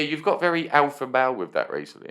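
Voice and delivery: usual speaking voice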